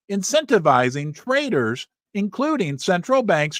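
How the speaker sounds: usual speaking voice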